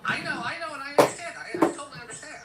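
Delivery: loud, high over-the-top sympathy; loud reassuring. Acted. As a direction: soothe her loudly and high.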